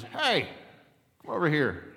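high, loud squawky parrot hail; parrot calling out. Acted. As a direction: call out as a cheeky joke parrot. Parrot in joke calling customer over.

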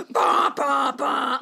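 loud vocal piano stings; mock piano bangs. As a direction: bang out dramatic piano notes with your voice. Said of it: "banged on some piano keys".

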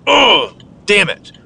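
acted frustration; mock annoyed. Shown as a demonstration. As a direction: demo of a flash of irritation. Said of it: "think about, that".

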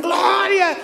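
high shouted gloria; a whole room yelling back; everyone shouting. Retold or imitated. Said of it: "Y todos gritan".

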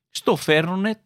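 ordinary talk, nothing marked

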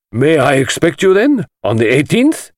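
high, showy voice of a flamboyant collector; theatrical host. Acted. The host lecturing the detective, grand and high-pitched. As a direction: relish every word like a showman.

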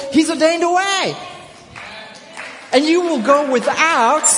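loud, high, rising preacher's cry; preaching at full force. Real, not acted. Preacher pressing the church to pray, loud and high. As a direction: drive it home to the congregation, full voice.

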